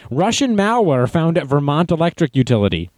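usual speaking voice

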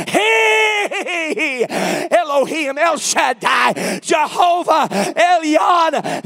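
loud, high roll call of holy names; full-force preaching. Real, not acted. Preacher proclaiming the Lord of glory, full-force shouted preaching. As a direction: thunder out each title with a long-held "He".

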